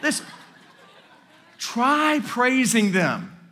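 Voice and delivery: normal talking voice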